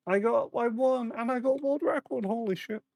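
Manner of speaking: high, cracking excited voice. Real, not acted. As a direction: voice breaking with excitement. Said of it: "I don't know why I'm voice cracking so much".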